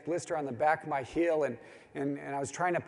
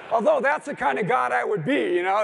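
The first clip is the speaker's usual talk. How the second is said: laughing; 7 semitones higher, 8 dB louder than usual. Real, not acted. Laughs at own joke.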